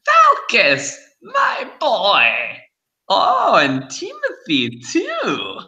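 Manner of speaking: put-on very high, delighted old crone; fortune teller. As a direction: greet them like a cackling hag. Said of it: "she says".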